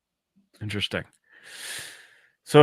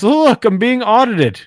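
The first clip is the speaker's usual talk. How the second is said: Carl; 10 semitones higher, 3 dB louder than usual. Retold or imitated.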